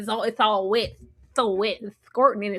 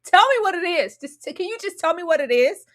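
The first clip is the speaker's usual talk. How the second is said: high, loud irritated snapping; fed-up girlfriend demanding answers; 8 semitones higher, 8 dB louder than usual. Retold or imitated. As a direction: snap at him to spit it out. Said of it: "she irritated".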